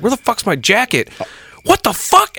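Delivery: high-pitched retold indignation; mock outraged disbelief. Courtney discovering her jacket is missing, mock outraged. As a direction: exclaim it high, acting out someone's disbelief.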